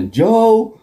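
high teasing call of Joe; mocking Triple H call. Teasing Vince as Triple H, high mocking call.

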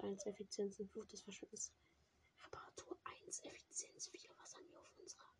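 soft whispered reading; whispering. Real, not acted. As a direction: whisper the enchantments under your breath. (Flüstern)

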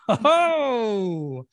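long drawn-out, delighted "oh"; hammed-up villainous delight; gloating bad bacteria. Bad bacteria seeing their chance, mock gloating.

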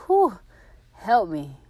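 high, loud whew then a pleading help me; overwhelmed. Retold or imitated. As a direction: overcome with emotion, begging for help. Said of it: "I'd be like".

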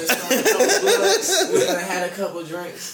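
laughing drawl. Real, not acted. Tipsy, laughing, drawn out.

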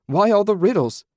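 high, loud exasperated shout. A narrator's reading. Susan shouting at the voice about its riddles. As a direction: throw the question out in fury. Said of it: "she shouted".

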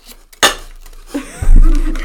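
loud burst of laughter; cracking up mid-sentence; laughing fit. Real, not acted. Reading letter, breaks up laughing.

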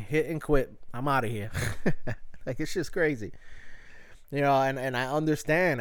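ordinary talk, nothing marked

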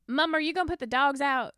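lightly imitated Mechanicsville hillbilly accent. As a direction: call out like a country kid. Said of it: "it was more hillbilly".

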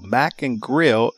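ordinary talk

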